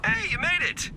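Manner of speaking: high, delighted hello; thrilled you showed up; excited greeting. Acted. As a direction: greet them with a high-pitched burst of welcome. Excited greeting on someone's arrival.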